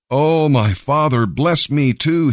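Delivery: high, drawn-out plea; begging. A narrator's reading. Son begs his father for a blessing too, imploring. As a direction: plead it slowly, pitched well up. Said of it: "he begged".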